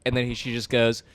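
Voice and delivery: ordinary talk, nothing marked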